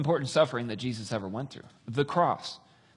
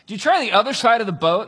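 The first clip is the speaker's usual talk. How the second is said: raised, high-pitched shout across distance; calling out; 8 semitones higher, 9 dB louder than usual. Retold or imitated. Man on shore advises fishermen, calling out. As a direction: holler a suggestion over the water. Stranger calls to the boat.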